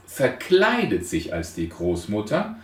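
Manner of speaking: ordinary talk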